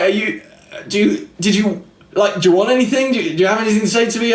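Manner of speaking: high, fast, tumbling questions; awkward flustered questions. Retold or imitated. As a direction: trip over the words, unsure. Retelling own awkward questions.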